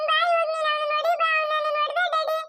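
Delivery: whimpering, tearful pleading; a touch of sobbing while begging; crying child. Acted. Little girl tearfully begging her father to hit him. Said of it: "ಯಾಕ್ ನೇಹ ಪುಟ್ಟಿ ಅಳ್ತಾ ಇದ್ಯಾ?".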